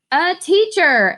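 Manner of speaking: loud, slow, high group answer; eager class call; class cheering loudly. Acted. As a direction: shout it out proudly together. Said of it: "Lily's response was the loudest".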